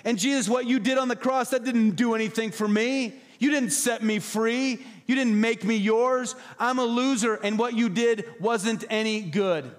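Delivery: high, loud, whiny self-pity; mock whine of a believer who says he is no good. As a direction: wail it like a defeated loser. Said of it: "Arrogant is saying".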